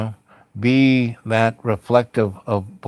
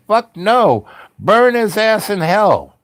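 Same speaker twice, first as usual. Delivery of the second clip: much higher and louder, a furious mob yelling; angry crowd shout; 10 semitones higher, 7 dB louder than usual. Retold or imitated. Crowd refusing to forgive the father, furious shout. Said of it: "Everyone said".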